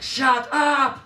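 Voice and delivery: loud, high mock scolding; over-the-top scolding, amused; mock yelling at pupils. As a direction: yell it as a joke-angry teacher. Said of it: "I'm not talking about things like".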